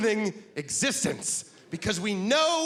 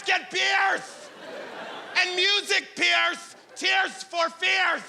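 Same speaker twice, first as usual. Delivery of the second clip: shouting guy; 12 semitones higher, much slower than usual. Retold or imitated. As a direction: bellow for your friend across the party. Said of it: "This is my impression of a guy".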